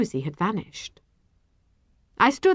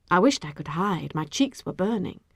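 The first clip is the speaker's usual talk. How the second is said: usual speaking voice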